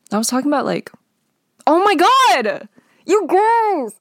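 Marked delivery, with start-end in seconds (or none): 1.7-4.0 high, loud cry of frustration; exasperated outburst. Real, not acted. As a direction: shriek oh my God at herself. Exclaims in frustration mid-thought.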